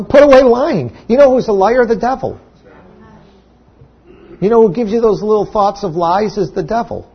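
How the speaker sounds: loud, high preaching push; fiery preaching. Acted. Preacher warning the church about the devil, loud fiery preaching. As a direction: drive the warning home from the pulpit.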